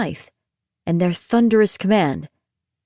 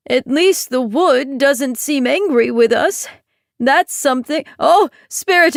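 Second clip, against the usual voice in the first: weary uncle; 5 semitones higher than usual. Acted.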